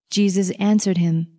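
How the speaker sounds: ordinary delivery, nothing marked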